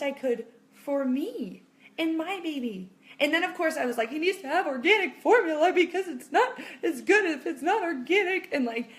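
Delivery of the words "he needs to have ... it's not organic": higher, louder mock insistence; hammed-up fretting; mock fussing. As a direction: parody an over-anxious parent with a laugh. Mocking her fussy new-mom worries.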